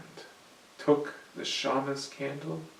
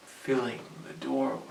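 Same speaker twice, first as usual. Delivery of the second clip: plain reading voice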